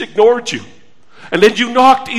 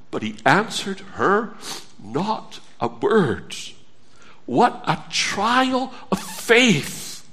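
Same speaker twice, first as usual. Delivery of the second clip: hushed and slow; 2 semitones lower, 9 dB quieter, slower than usual. Acted. Preacher stressing the woman's trial.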